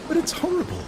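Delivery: high, recoiling cry of dismay; a woman recoils from the stormy night; frightened woman. A narrator's reading. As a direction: shrink back from the dark sea. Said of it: "shrinking back from the window".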